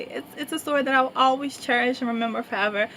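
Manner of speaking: ordinary talk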